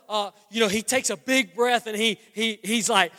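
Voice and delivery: ordinary talk, nothing marked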